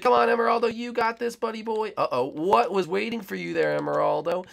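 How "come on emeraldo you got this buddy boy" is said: higher, bright cheerleader voice; higher mock cheer for a buddy. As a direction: cheer him on in a silly put-on voice. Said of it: "He's like".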